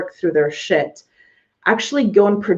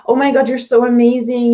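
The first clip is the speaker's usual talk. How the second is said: higher, louder gushing client; gushing praise; 5 semitones higher, 4 dB louder than usual. Retold or imitated. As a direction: gush over the work like a thrilled client.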